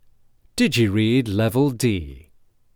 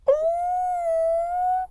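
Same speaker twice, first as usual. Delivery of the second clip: tiny, very high mouse squeal; frightened squeak; 4 dB quieter than usual. A narrator's reading. Little mouse scolded by the lion, frightened squeak. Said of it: "squeaked again in a frightened voice".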